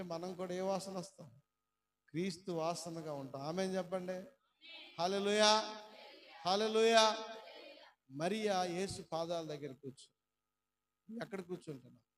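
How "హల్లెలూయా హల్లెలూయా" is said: louder, rising hallelujahs called out; hallelujah shout. Real, not acted. Preacher calling the congregation to shout hallelujah. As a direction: rouse the church with it.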